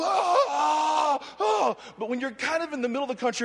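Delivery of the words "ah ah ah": high mock cries of ah; Southern church cry. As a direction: mimic the Southern worshippers' ah-ah. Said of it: "people go".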